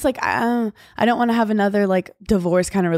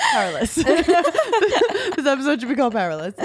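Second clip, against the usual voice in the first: laughing; 9 semitones higher, faster than usual. Real, not acted. Laughing fit over running joke.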